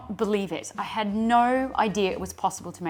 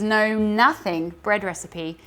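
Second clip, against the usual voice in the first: ordinary delivery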